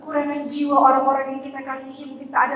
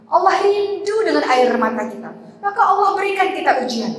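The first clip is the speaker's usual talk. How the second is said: higher, louder impassioned sermon; fervent preaching; 7 semitones higher, 5 dB louder than usual. Acted. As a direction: press the point home, pleading with the women. Preaching at full force, raised. (khotbah berapi-api, lebih tinggi dan keras)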